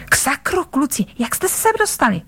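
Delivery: sudden indignation. A narrator's reading. Barmaid catches boys sneaking out, high-pitched and cross. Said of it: "rozčílila se najednou Jenova".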